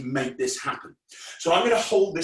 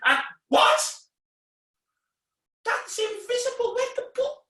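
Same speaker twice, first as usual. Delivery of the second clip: hammed-up astonishment, way up high; mock shock. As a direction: squeak in put-on disbelief. Ball vanishes, magician feigns shock.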